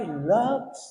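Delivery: a tender Loves on the phone to his wife; sweetly said Loves. Retold or imitated. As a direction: coax Loves like a loving husband. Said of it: "ang ganda pa ng sabi".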